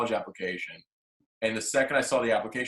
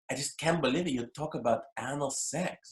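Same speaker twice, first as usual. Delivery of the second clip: mom's higher-pitched Russian-accented voice, mock reproach; imitating Russian mother; 4 semitones higher, 4 dB quieter than usual. Comic telling his Russian mom's review of his show, imitated Russian accent. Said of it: "And she goes".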